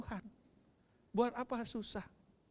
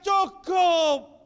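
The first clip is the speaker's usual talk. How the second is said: loud high cry of enough; child screaming enough; shout; 16 dB louder than usual. Acted. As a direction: scream it out in pain. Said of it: "Dia teriak".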